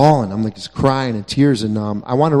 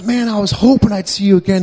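excited friend; 8 semitones higher, 4 dB louder than usual. Retold or imitated. Friend runs over happily.

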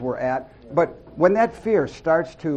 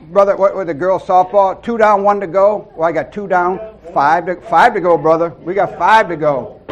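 louder, upbeat calling out; excited banter; 4 semitones higher, 8 dB louder than usual. Real, not acted. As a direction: call to the brother with energy. Banter celebrating progress.

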